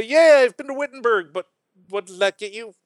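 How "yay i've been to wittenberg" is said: high, loud gushing tourist; mock tourist boast. Mocking a bragging tourist. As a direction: brag like a giddy tourist.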